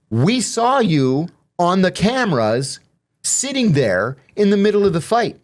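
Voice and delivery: high, slow, deliberate accusing; mock stern accusation. Officers confronting inmate with camera evidence, imitated accusation.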